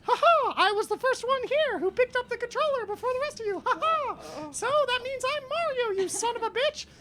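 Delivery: very high, loud cartoon voice with a cackle; shrieking falsetto gloating; Walt Disney. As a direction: squeal it like a manic cartoon mouse. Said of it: "Walt Disney goes".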